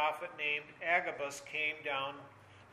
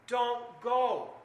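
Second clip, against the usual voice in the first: higher, louder pleading cry; urgent plea, raised; 5 semitones higher, 7 dB louder than usual. Acted. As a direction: beg him to stay. Voicing weeping friends begging Paul.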